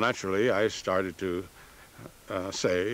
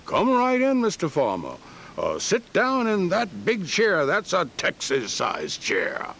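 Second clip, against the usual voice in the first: hearty, much higher mock Johnson welcome; Johnson greeting; 10 semitones higher than usual. Farmer imitates Johnson welcoming him into the office, hammed-up and high. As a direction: play the booming host, lightly imitated.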